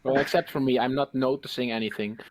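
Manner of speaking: ordinary talk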